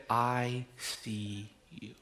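very slow and soft; tender. Retold or imitated. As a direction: let each word land gently, close and intimate. Wife's tender words retold.

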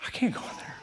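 breathy whisper of his own panicked thought; whispered inner thought. Retold or imitated. Man whispering his dread about facing his old mentor. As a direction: hush it like a thought you daren't say aloud.

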